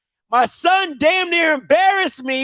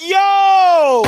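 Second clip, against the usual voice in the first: high, louder startled Yo; shocked yell; 7 semitones higher, 6 dB louder than usual. Real, not acted. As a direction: jolt out a shocked Yo. Shocked at son's rudeness.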